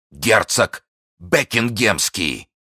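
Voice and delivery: high, loud, impassioned denunciation; outraged exclamation. A narrator's reading. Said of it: "повторял Фельтон".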